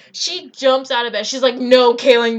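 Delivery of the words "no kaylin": loud, emphatic retold no; acted-out urgent stop; alarmed refusal. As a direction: cut her off loudly, as the alarmed friend. Friend urgently stops her eating more.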